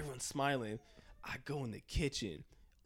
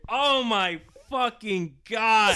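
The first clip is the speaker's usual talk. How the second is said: stunned dread; 7 semitones higher, 12 dB louder, much slower than usual. Retold or imitated. Walking into a party, dread.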